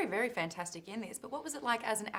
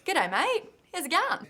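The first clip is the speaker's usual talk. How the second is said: put-on chirpy Australian accent, higher pitch; 9 semitones higher, 8 dB louder than usual. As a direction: greet like a cheery Aussie. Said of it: "How's your Australian accent?".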